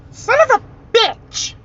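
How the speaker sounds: very high squeaky mock curse; cartoon creature voice. Prankster answers the TV from the closet, high squeaky outburst. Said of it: "I answered back with a".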